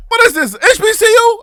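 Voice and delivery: high, loud disbelieving shout; shocked outcry. Real, not acted. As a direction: yell it out in total disbelief. Outraged at the dinner price.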